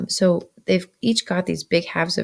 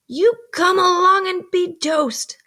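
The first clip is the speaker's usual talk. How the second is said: much higher, louder, slow threat; awful words; 12 semitones higher, 5 dB louder, slower than usual. A narrator's reading. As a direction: deliver it like a grim order. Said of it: "the awful words".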